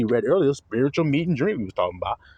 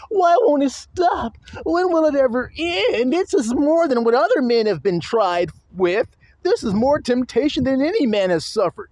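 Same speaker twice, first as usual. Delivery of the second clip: mock wailing; 10 semitones higher than usual. Preacher mocking a complainer crying out about temptation. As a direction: ham up the tormented complainer.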